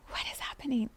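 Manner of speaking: a puzzled breathless whisper. Retold or imitated. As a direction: hush it as if asking yourself. Baffled inner voice mid-experience.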